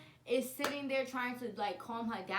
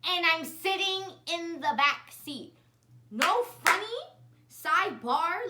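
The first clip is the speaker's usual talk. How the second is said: high, loud excited aside; 6 semitones higher, 9 dB louder than usual. Real, not acted. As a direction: burst in with a fired-up sidebar.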